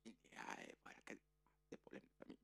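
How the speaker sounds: hushed family gossip; confidential hush. Acted. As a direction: lean in and confide the secret.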